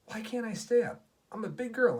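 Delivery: put-on high, loud little-girl whine of protest; protesting child. A narrator's reading. Reese asking Daddy why she can't stay up, protesting. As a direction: plead indignantly like a child.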